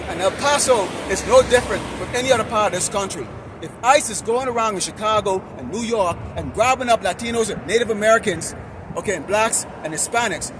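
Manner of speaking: loud, hard-driving sermon with punched words; forceful street preaching. Real, not acted. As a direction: berate the crowd like a street preacher. Street preaching, scolding listeners.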